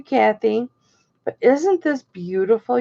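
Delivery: ordinary delivery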